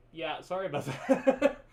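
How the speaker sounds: sheepish apology that tips into laughter; laughing apology. Real, not acted. Streamer apologizes to guest for echo, laughing. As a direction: say sorry, breaking into a laugh.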